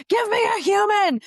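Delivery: high, loud, drawn-out shout; yelling at phone robot. Retold or imitated. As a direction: holler it slowly in mock exasperation. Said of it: "yell at them".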